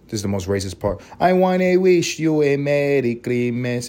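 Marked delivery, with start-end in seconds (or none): 1.2-3.9 slow, sung in a hammed-up Spanish accent; mock Spanish accent. Mock-singing the English carol line to listeners in a Spanish accent. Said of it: "doing a Spanish accent with the English part".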